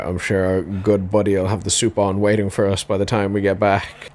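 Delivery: ordinary talk, nothing marked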